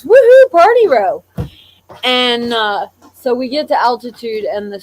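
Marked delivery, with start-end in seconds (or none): 0.0-1.2 high, loud party whoop; woman in the row cheering about the party row, loud and high; excited cheer. Retold or imitated. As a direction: whoop it like a tipsy passenger finding her seat. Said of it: "and she goes".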